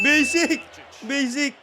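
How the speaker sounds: high, punchy cheer; excited fan shout; excited calling out. Real, not acted. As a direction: call the play out loud. Cheering a play in the game.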